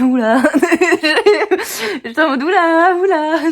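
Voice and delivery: high, laughing, sing-song oula. Retold or imitated. Retelling her reaction to the old man's question, laughing. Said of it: "Je fais".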